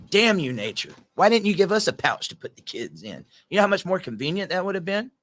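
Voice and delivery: dad mock-scolding nature over the missing pouch; mock rant. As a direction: shake a fist at nature, half joking.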